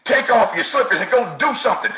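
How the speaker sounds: ordinary delivery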